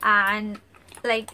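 normal talking voice

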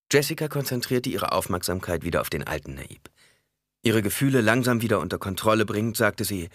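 ordinary delivery, nothing marked

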